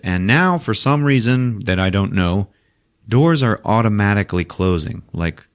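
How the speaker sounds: ordinary talk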